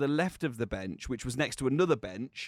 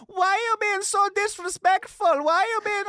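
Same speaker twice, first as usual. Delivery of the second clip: much higher, louder, whiny mock indignation; mocking girls' voice; 8 dB louder than usual. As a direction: parody the two girls scolding. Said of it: "then they were like".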